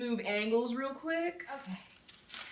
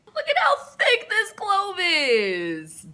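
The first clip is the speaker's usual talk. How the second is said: loud, high, drawn-out whine; hammed-up tearful complaint; exasperated wail; 6 semitones higher, 8 dB louder, slower than usual. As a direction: wail it slowly in comic outrage. Comic meltdown over garlic clove.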